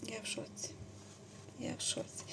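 soft, breathless whisper; stunned whisper. Real, not acted. Knitter overwhelmed by a friend's gift, whispering.

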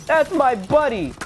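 high, loud hero yell, slow; protective shout. Pretend. Gamer yelling to guard his friend, high heroic shout. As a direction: roar to defend a fallen buddy.

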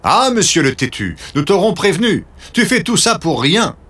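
high, scolding tease; annoyed mocking. A narrator's reading. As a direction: scold him with a high sneer. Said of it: "Certains de ses amis se fâchent".

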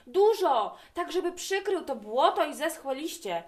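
a loud DUŻO, then easing down to eager talk; child begging Dad for lots of snow, loud then calmer; excited shout, fading. A narrator's reading. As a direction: shout it, then settle back. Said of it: "zawołałem".

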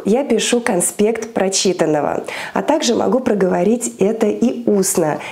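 ordinary talk, nothing marked

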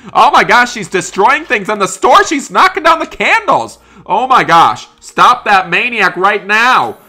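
high, loud cries of alarm; alarmed shouting. Acted. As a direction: shout in panic as the store gets wrecked. Store being wrecked, calling out.

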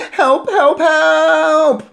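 loud, high cries, last one held long; terrified screaming. A narrator's reading. Boy hoping somebody outside might hear, screaming in terror. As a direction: scream repeatedly for rescue. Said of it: "I screamed".

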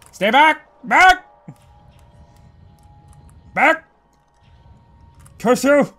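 high, loud cries of back; frightened yell. Real, not acted. Player yelling at the demon girl to stay back.

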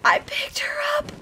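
high, squeaky excited whisper; breathless hushed squeal; awed whisper. Real, not acted. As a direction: whisper it, barely containing delight. Repeats it in awe.

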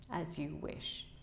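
slow, quiet, cold assent; understated icy courtesy. A narrator's reading. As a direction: say it coldly, drawn out and soft. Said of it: "he said coldly".

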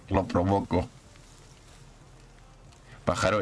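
usual speaking voice

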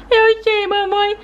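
put-on high childlike voice of a small daughter; little girl voice. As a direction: play the sick little girl talking to mom. Doll answers.

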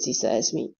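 usual speaking voice

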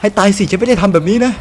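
high, alarmed character voice; dubbed character panicking. Acted. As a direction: cry out as the car spins away. Character panics, car out of control. (พากย์ตัวละครตกใจเสียงสูง)

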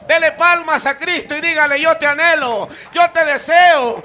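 loud, high declaration of devotion; full-force preaching. Acted. Preacher leading the crowd to declare love to Christ, full-force preaching. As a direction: cry it out to the congregation, stretching the phrases.

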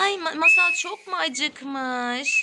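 normal talking voice

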